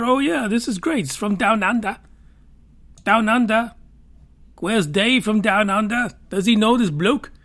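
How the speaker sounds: playing an excited Aussie who asks after Dave from Down Under; Australian accent. Host trying his Australian accent on a bloke story. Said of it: "fix my Australian accent".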